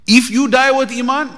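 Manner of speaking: a hint of a sermon climax; slow emphatic warning. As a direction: lay each word down for the king.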